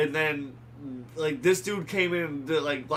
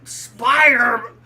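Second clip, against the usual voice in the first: slow growled Spider-Man, louder; barking newspaper editor; 7 semitones higher, 9 dB louder, slower than usual. Shown as a demonstration. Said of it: "you gotta have the right growl in your voice".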